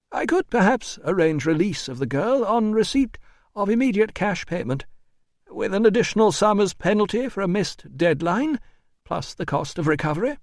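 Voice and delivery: high, fussy official voice; officious administrator. A narrator's reading. Administrator offering terms to the doctor, high and officious.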